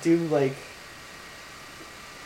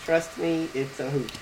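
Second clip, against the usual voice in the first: ordinary talk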